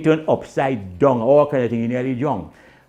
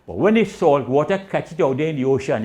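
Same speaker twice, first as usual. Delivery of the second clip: ordinary talk, nothing marked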